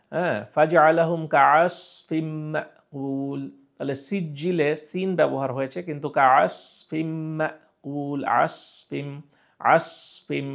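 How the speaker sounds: কাআসফিম drawn out with a heavy s; heavy sad. Shown as a demonstration. Teacher reciting very slowly, melodic. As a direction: stretch আসফিম with a thick s. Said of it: "কি ব্যবহার করা হয়েছে? সদ".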